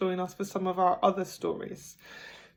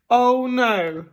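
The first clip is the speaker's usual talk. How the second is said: fed up; 4 semitones higher, 6 dB louder than usual. Acted. Mr Bear frustrated.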